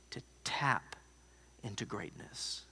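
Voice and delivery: soft whispered close, slowing down; preacher's soft whispered punchline; hushed whisper. Real, not acted. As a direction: drop to a whisper to land the point.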